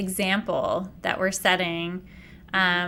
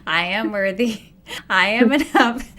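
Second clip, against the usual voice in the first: mock mirror affirmations; 5 dB louder than usual. Retelling her first mirror affirmations, mock earnest and rising. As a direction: recite the affirmations to the mirror with growing force.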